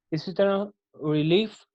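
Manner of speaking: Relief said slowly with syllables marked; stress demo. Shown as a demonstration. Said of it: "one stressed, unstressed". (Relief धीरे, तनाव के साथ)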